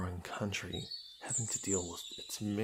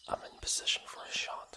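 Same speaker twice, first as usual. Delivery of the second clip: quick hushed aim; whispered; faster than usual. Acted. Princess aiming her bow at the boar, whispering. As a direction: whisper it fast while lining up the shot.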